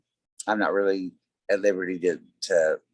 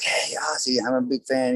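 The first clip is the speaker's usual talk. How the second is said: higher-pitched retold greeting to an idol; mock fan calling out in a raised pitch; eager fan call; 5 semitones higher than usual. Fan greets [name] at the ramp, higher-pitched eager call. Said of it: "I was like".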